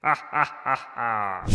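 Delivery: low fading villain laugh; evil laugh. Acted. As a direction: let the laugh sink away. Villain gloats alone.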